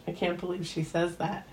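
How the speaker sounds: ordinary delivery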